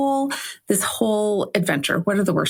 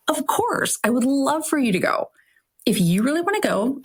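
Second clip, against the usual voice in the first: high, sweet, eager "of course" to the husband; eager reassurance; 7 semitones higher than usual. Retold or imitated. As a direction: sound keen and supportive. Reassuring husband he should come.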